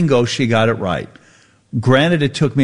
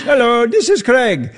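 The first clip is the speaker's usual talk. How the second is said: mock cheerful agent; 11 semitones higher than usual. Imitating the human agent Craig.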